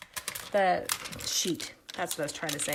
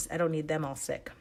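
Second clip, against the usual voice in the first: usual speaking voice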